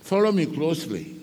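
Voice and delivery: normal talking voice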